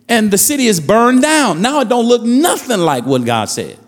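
fervent preacher cadence, pressing each line; preaching. Acted. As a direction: preach it to the congregation. Preaching at full force.

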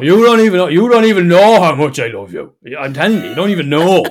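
put-on loud, sloppy drunken declaration of love; drunk gushing. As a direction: slur it out like a sentimental drunk at closing time. Drunk friend gushing, acted.

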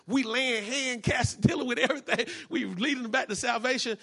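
ordinary talk

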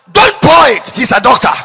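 loud booming command from heaven; shouted heavenly warning. Retold or imitated. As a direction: bellow it as the voice from above. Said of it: "another voice shouted".

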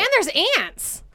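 high-pitched, upset exclamation; distressed outburst. Real, not acted. Complaining there are ants too, high and distressed.

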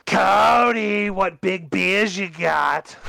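high, slow storybook question. Acted.

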